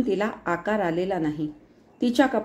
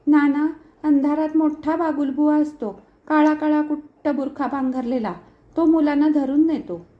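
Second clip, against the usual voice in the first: put-on high little-child voice; little girl; 6 semitones higher, 4 dB louder than usual. A narrator's reading. Small girl tells Nana of the bogeyman, high child voice. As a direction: pitch it up like a small, scared child. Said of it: "लहानपणी ... नानांना बिलगून ... नि म्हणे".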